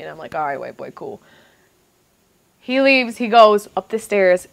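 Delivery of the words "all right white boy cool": low, soft, unimpressed agreement; muted reply. Retold or imitated. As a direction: play it down under your breath. Her muted reply to his boast.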